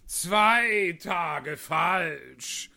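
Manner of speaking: drawn-out, very slow sigh; sighing Hatter. A narrator's reading. The Hatter laments his broken watch, sighing slowly. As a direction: groan the words out wearily. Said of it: "seufzte der Hutmacher".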